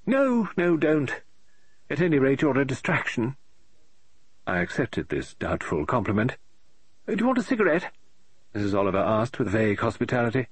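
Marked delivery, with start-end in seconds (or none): 0.1-3.4 high, fussy woman's voice, rising in exasperation; an eccentric lady author tearing her hair; fretting novelist. Acted. Mrs Oliver asks Mark to stay. 7.1-7.9 high, fussy woman's voice, rising in exasperation; an eccentric lady author tearing her hair; fretting novelist. Acted. Mrs Oliver offers cigarette.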